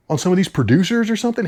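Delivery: ordinary talk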